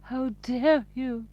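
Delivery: high, loud, slow mock indignation; drawn-out, put-on affront; pompous imitation. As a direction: mimic their pompous outrage. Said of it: "They do sound so pompous".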